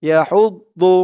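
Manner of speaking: "ইয়া হৌদ দু" with heavy, full-mouthed "দু"; full-mouthed daad. Shown as a demonstration. Teacher showing the correct daad, heavy "দু". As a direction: fill the mouth on the d of "দু". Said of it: "মুখটা ভরপুর হয়ে গেছে".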